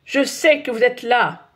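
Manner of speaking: slow warning shout to the intruders. A narrator's reading. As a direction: call into the dark garden. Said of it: "crie le papa de Nicolas".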